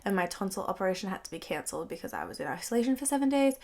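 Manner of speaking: ordinary delivery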